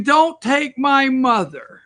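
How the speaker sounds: put-on high, slow plea of a little girl; mild mock wail of a crying girl; mock crying child. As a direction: imitate a sobbing child begging. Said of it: "that's crying".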